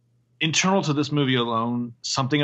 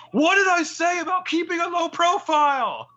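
screaming. Retold or imitated. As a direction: yell like an angry vampire boss. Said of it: "And then Riley screams".

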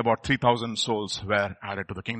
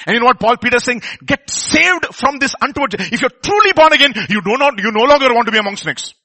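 loud, high, urgent sermon delivery; impassioned pulpit exhortation; forceful preaching; 9 semitones higher, 10 dB louder than usual. Real, not acted. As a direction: preach it out at full voice.

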